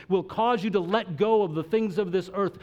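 ordinary delivery

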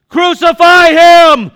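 screamed very high and loud, slow; crowd crying out. Acted. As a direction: howl it like an angry mob. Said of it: "they cried out".

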